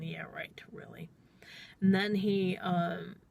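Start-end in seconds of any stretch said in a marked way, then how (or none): 0.0-1.1 속으로 비꼬는 속삭임; 믿지 않는다는 듯 숨죽여 혼잣말; sarcastic whisper. Retold or imitated. Silent skeptical inner thought.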